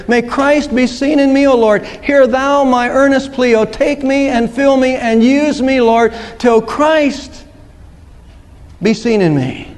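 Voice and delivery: slow, earnest prayer in verse; prayer recital. Acted. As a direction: recite it as a heartfelt plea, drawing out each phrase.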